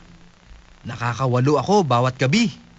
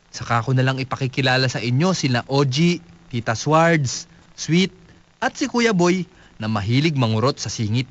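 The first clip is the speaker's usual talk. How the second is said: ordinary talk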